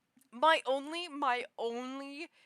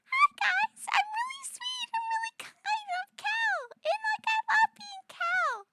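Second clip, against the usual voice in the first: squeaky, very high and loud; Cal voice; 7 dB louder than usual. Pretend. Streamer showing her Cal voice, squeaky high. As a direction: go as high as you can, sugary. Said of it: "I can do".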